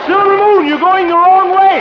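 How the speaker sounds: high, loud, frantic yell at the horse. Acted. Harold yells at his runaway horse, loud and frantic. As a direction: holler after a horse running the wrong way.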